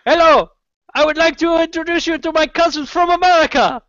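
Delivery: put-on high, quick comic Italian accent; hammed-up Italian accent. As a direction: announce it grandly in a high mock-Italian voice. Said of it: "tiny Italian power walker".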